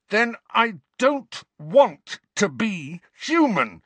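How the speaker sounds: high, loud, slow anguished roar; a boy's anguished cry. A narrator's reading. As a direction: roar it out in despair. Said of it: "Harry roared".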